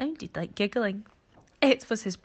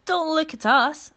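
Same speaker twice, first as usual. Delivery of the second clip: much higher and louder, taken aback; indignant elephant; 11 semitones higher, 9 dB louder than usual. Acted. Elephant denies it to the monkey, high and loud protest. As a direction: protest your innocence loudly. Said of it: "said Elephant".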